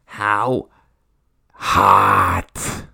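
second word dropped very low and loud; leering voice. Acted. Comic asking how hot, low leering growl. Mock lecherous emphasis.

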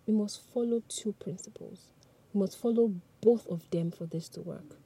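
ordinary talk, nothing marked

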